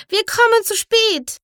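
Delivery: very high, loud squeal of panic; alarmed cry. A narrator's reading. Startled rabbit checks his watch, crying out in alarm.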